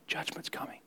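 whispered warning of doom; ominous whisper. Acted. Preacher voicing Noah warning the world, whispered. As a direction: breathe it as a dark warning.

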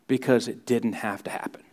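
hushed whispered ending. Real, not acted. Preacher hushing to a whisper for the congregation, whispered. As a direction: drop into a whisper to land it.

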